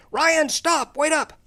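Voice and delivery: high-pitched retold yell. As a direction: call after a running toddler. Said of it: "I'm yelling".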